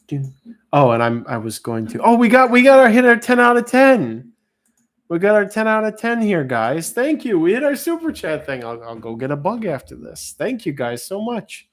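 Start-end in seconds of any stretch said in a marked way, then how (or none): 2.0-8.6 thrilled, high and loud; excited. Real, not acted. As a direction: celebrate the goal with a cheer. Super chat goal reached excitedly.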